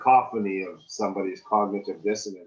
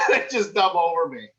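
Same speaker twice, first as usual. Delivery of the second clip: laughing it off, higher and louder; a laughing admission, higher and louder; 7 semitones higher, 5 dB louder than usual. Real, not acted. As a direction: laugh through the punchline.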